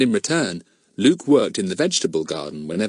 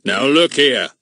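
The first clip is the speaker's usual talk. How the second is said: pompous policeman; 5 semitones higher, 6 dB louder than usual. A narrator's reading. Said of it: "said Mister Goon".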